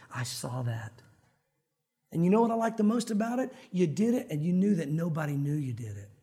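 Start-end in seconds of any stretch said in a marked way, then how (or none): 0.1-1.0 low, hushed, knowing; quiet voice of God. Acted. God privately tells the helper, quiet and low. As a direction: say it much lower and softly.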